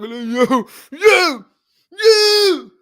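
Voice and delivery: loud, high wailed protest; mock crying. Man crying over being called Blackie, mock wail. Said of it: "You gonna be crying like".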